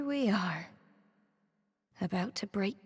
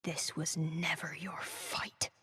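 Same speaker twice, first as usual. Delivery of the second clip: hushed unvoiced whisper; 3 semitones lower, 7 dB quieter than usual. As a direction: breathe it out in a whisper.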